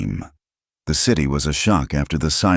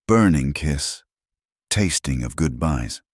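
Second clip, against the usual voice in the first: ordinary delivery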